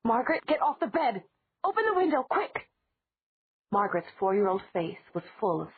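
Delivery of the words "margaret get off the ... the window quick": urgent high commands, climbing higher; shouting. Acted. Elena shouts orders to Margaret through the window, urgent high shout. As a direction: bark orders at the child fast. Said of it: "Elena shouted again".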